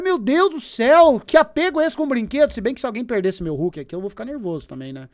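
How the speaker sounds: normal talking voice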